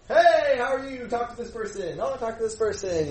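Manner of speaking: breezy fake politeness, hiding nerves; mock small talk. Pastor mocking himself making nervous small talk, cheery. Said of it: "like, you know".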